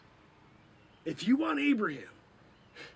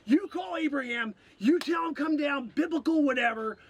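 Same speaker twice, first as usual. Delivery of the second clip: ordinary talk, nothing marked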